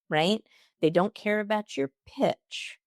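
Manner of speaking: ordinary talk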